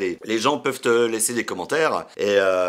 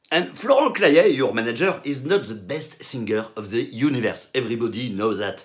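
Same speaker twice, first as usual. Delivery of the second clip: put-on English with a thick French accent; terrible French accent. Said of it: "My accent is nul à chier". (anglais avec un gros accent français)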